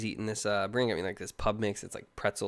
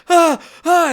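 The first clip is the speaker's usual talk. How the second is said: put-on kid's strained cries; 15 dB louder than usual. Kid yanking tooth with golf ball.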